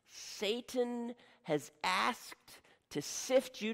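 very slow, solemn warning; slow and heavy, like a warning; slow grave warning. Acted. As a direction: drag each word out with weight.

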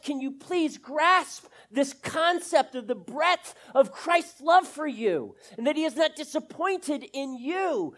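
loud, high, driving sermon voice; preaching at full force. Acted. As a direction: preach it out with growing fire.

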